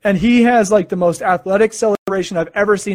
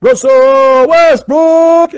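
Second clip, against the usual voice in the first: drawn-out, much louder and higher arena call of the name; announcer freaking out; 8 semitones higher, 8 dB louder, much slower than usual. Retold or imitated. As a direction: stretch the name like a hyped announcer. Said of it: "announcer for Denver is freaking out".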